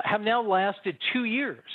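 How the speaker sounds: ordinary talk, nothing marked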